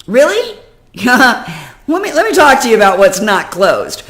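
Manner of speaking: indignant retort. Real, not acted. Answering the agent's email, loud indignant retort. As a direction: fire back in disbelief.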